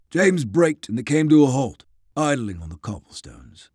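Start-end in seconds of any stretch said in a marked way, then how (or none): none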